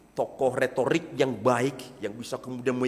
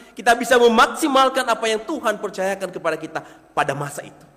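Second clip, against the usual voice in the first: much higher and louder, fervent pulpit voice; preaching; 11 semitones higher, 12 dB louder than usual. Acted. Pastor preaching on Moses to the congregation, high and loud. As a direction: preach it out to the hall.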